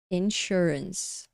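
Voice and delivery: Insurance said slowly and softly as a model word; model pronunciation. Shown as a demonstration. Teacher models Insurance slowly for the students. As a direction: say Insurance slowly, stress on SUR. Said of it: "a gente chama de insurance".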